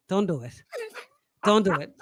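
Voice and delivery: ordinary delivery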